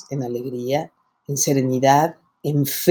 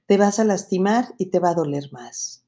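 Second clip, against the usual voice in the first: ordinary talk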